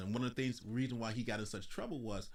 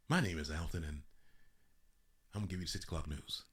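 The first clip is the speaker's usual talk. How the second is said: lower, quicker slick anchorman intro; smooth newsreader voice; 6 semitones lower, 3 dB quieter, faster than usual. Retold or imitated. Mocking a handsome anchor greeting viewers, lightly voiced newsreader. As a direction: play the pretty-boy TV anchor, a touch smooth. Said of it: "have a really nice voice".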